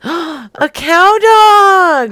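gasp, then high, loud, drawn-out cry; excited shout. Pretend. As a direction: shout it with huge excitement, stretching it out. Gasping, excitedly announcing cow dog.

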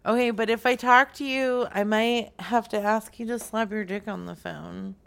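usual speaking voice

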